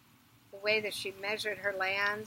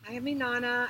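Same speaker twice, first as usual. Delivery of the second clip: loud, higher insistence; insistent; 4 semitones higher, 8 dB louder than usual. Acted. Inanna insists on her name at the gate, louder.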